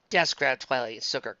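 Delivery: plain reading voice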